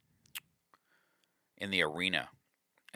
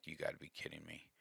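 a fast, much softer mutter of disbelief; quiet disbelief; 2 semitones lower, 15 dB quieter, faster than usual. Retold or imitated. Sinking in his seat at the winner's story, muttering fast and soft. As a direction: drop the voice and mutter it quickly, sinking in your seat. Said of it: "and I'm like".

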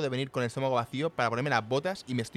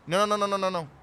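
urgent no; 6 semitones higher, 5 dB louder than usual. Acted. Stopping friend from leaving.

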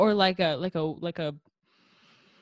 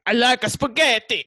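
loud, high mock Italian; hammed-up Italian caricature; 6 semitones higher, 5 dB louder than usual. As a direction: put on a cartoon Italian accent. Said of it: "the stereotypical Italian accent".

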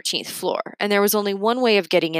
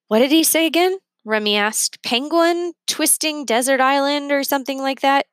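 Remy's voice; 8 semitones higher than usual. Acted. Remy confused, voiced high.

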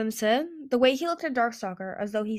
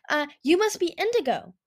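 high, loud, overeager; awkward greeting; 9 semitones higher, 5 dB louder than usual. A narrator's reading. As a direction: greet a stranger too brightly. Clearsight greets bodyguard awkwardly.

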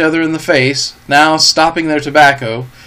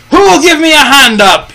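much higher, louder pirate roar; 12 semitones higher, 7 dB louder than usual. A narrator's reading. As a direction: bellow at the men in fury. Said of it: "he roared".

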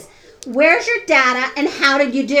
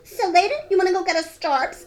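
higher-pitched, affected invite; mock cutesy slang-speak; 6 semitones higher than usual. Co-host mocking people who say Starbs, mock cutesy. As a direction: put on a silly high voice for the slang. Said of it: "I don't wanna talk like that".

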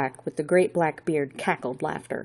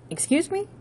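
high, rising questioning tone; puzzled query; 13 semitones higher than usual. A narrator's reading. Chauffeur querying an odd remark from a caller, puzzled. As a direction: pitch leaps up in a puzzled question.

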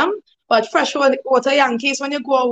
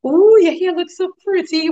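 higher-pitched mock flattery; sweet talking; 6 semitones higher, 3 dB louder than usual. Demonstrating how to butter somebody up, put-on sweet talk. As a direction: gush a fake compliment, pitched up. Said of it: "you have to, like, say".

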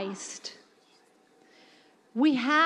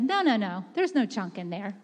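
dismissive denial; 6 semitones lower, 4 dB quieter than usual. Acted. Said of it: "That was how they acted".

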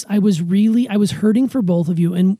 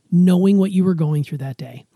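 ordinary talk

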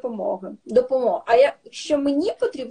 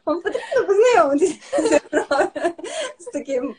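laughing; 6 semitones higher, faster than usual. Real, not acted. Laughing mid-explanation.

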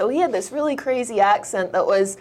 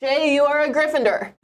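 higher, proclaiming like the Sorting Hat; grand sorting announcement; 6 semitones higher than usual. Pretend. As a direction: announce the house with ceremony. Sorting Hat game, announcing house.

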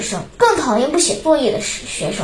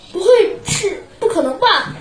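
disbelief; 8 semitones higher than usual. Acted. Boy disbelieves father's praise.

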